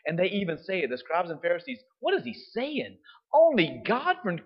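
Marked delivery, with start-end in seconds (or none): 2.0-4.4 high-pitched, scandalized protest; mock outrage. Scribes and Pharisees objecting to Jesus, mock outrage. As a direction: imitate indignant objectors, pitch jumping up.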